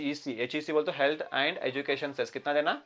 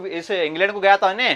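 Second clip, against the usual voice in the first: usual speaking voice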